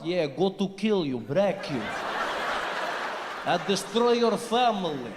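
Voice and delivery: put-on slow, menacing Russian accent. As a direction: threaten in a heavy Russian accent. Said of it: "As soon as they open their mouths".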